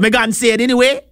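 high, quick mock Jamaican; badly faked Jamaican, rattled off high; terrible Jamaican accent. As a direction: carry on in a bad Jamaican accent. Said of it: "That was a terrible accent".

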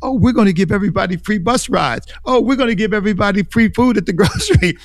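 high, quickening mocking sales pitch; mock giddy promise. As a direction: parody someone gushing over giveaways. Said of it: "it sounds great to a young person".

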